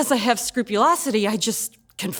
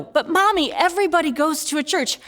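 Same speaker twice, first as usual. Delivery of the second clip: put-on higher, pleading little-kid voice; protesting child; 7 semitones higher than usual. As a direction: whine to Mommy like a six-year-old. Child protesting to her mother.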